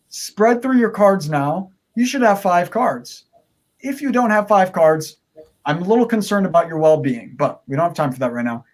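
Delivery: ordinary talk, nothing marked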